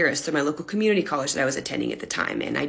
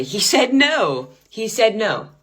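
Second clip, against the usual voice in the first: higher, slow incredulous repetition; stunned disbelief; 4 semitones higher, slower than usual. Retold or imitated. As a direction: say it in slow shock.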